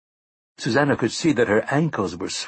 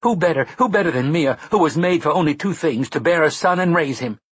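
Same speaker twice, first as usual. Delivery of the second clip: high, fast and loud; shrill; 7 semitones higher, 4 dB louder than usual. A narrator's reading. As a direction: rattle it off shrilly, indignant. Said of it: "Mia asked shrilly".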